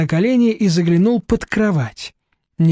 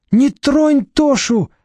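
high, drawn-out shriek; squealing; 9 semitones higher, much slower than usual. A narrator's reading. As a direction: squeal it in panic. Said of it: "завизжала Люська".

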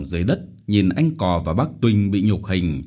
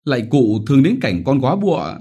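louder, higher begging; pleading; 6 semitones higher, 4 dB louder, faster than usual. Acted. Widow begging the chief for pity, pleading. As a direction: beg while soothing the child. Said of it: "vừa dỗ nó vừa lạy".

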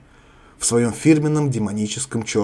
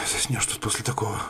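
mumbling; 9 dB quieter than usual. Acted. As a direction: mumble it under the breath. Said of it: "пробубнила Сара".

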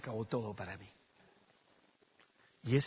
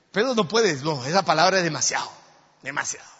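louder, pressing the point; loud emphatic; 5 semitones higher, 13 dB louder than usual. Acted. Preacher to congregation, loud and emphatic. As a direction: drive the point home.

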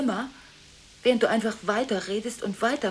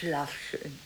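lower, much softer goodnight; 7 semitones lower, 7 dB quieter than usual. Acted. Saying goodnight tenderly to Gigi, very softly. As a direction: let it fall gently into a hush.

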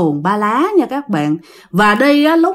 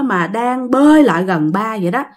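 usual speaking voice

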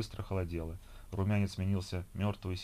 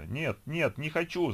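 a mild acted cry of panic, pitched up; frightened cry; 8 semitones higher, slower than usual. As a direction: protest in fear, as if falling into the muck. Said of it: "закричала она".

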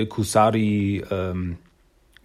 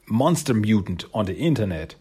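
ordinary talk, nothing marked

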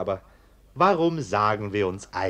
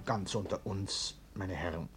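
hushed aside; 2 semitones lower, 10 dB quieter than usual. Acted. Biedermann whispers to the arsonists, hushed and urgent. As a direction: keep it between us, barely voiced. Host lowers voice, secret aside.

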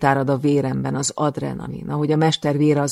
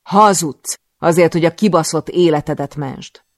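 continued shouting; 3 semitones higher, 10 dB louder than usual. Acted. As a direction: keep yelling at him. Keeps shouting the accusation. (folytatott dühös ordítás)

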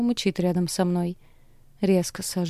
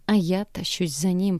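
usual speaking voice

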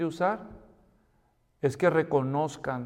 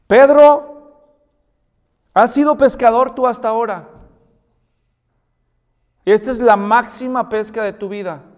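loud, high call across the water; voiced Jesus summoning Peter; 5 semitones higher, 12 dB louder than usual. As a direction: call him by name with urgency.

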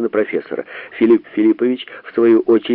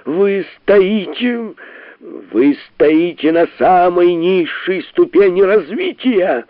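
high, slow shouting-down; shouting over; 7 semitones higher, slower than usual. A narrator's reading. The professor shouts over Sharikov, slow and high. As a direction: drown him out, slowly. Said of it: "перекричал Филипп Филиппович".

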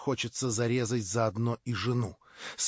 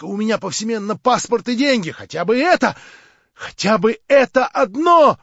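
high, loud feverish rant; frenzied tirade; 6 semitones higher, 6 dB louder than usual. A narrator's reading. Verkhovensky raving at Stavrogin in a frenzy.

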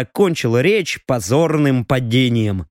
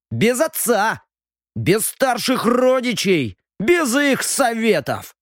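put-on higher, slow, boastful young lord; proud drunk youth; 7 semitones higher, slower than usual. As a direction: boast like a tipsy young noble.

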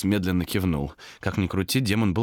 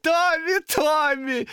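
very high, drawn-out name-calling; patronising demon; 4 dB louder, much slower than usual. Acted. Demon chides shouting Томас, patronising.

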